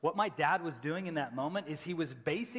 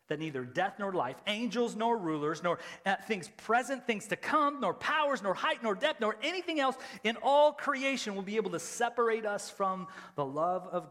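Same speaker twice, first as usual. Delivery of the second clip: rising, louder scripture climax; each nor climbing higher and louder; preaching build; 9 semitones higher, 7 dB louder than usual. Acted.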